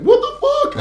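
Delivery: high, loud, laughing shock; imitating shocked girlfriend. Girlfriend seeing the mangled hand, shocked laughing shriek. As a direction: squeal it like a horrified girlfriend.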